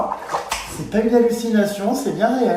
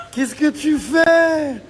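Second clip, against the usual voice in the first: teasing; 7 semitones higher, 4 dB louder, much slower than usual. Acted. Teasing question.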